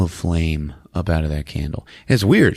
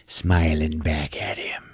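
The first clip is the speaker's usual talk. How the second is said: whispered for spooky effect; creepy whisper; 4 semitones lower, 5 dB quieter, slower than usual. Retold or imitated. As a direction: sink into an eerie whisper. Creepy reflection in the retelling.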